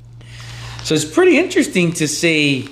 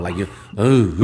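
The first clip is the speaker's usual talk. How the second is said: mock smooth-talker oohs; suave impression; 8 semitones lower than usual. Host teasing guest about how he talks, mock suave. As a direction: tease with a low suave croon. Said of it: "like Don Suave".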